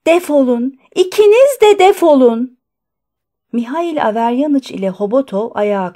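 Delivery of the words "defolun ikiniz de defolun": building high, loud yell; angry shout. A narrator's reading. Doctor throwing both visitors out, shouting angrily. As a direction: shout them out, rising louder on the repeat. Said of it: "diye bağırdı".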